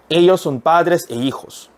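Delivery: slow, deliberate repeat; slow reading. Shown as a demonstration. Teacher repeating the sentence slowly for students. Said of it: "한 번 더".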